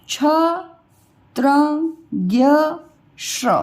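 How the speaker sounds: each letter from क to श्र alone with a short schwa; teacher drilling consonants, long pause after each; repeat-after-me consonants. Shown as a demonstration. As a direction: model every consonant with a brief 'uh', never aa. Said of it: "मैं इसको आपको repeat करवाती हूँ".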